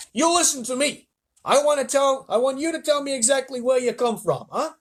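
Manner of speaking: high and loud; police chief interrogating the bugs, loud; demanding shout. Acted. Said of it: "shouted the chief of police".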